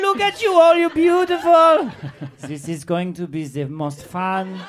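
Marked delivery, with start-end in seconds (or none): 0.0-1.9 gushing French dog. Acted. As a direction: gush at the crowd in a French accent. Excited dog gushes at the audience.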